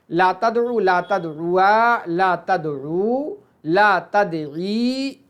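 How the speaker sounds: drawn-out chant of verb forms; slow drilled recitation. Shown as a demonstration. Teacher drilling the class, slow and drawn out. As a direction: recite each form slowly. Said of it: "teacher reciting negative conjugation".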